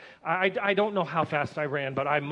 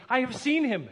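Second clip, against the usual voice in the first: high, joyful call; 7 semitones higher than usual. A narrator's reading. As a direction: call it out with sudden joy. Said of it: "she called out".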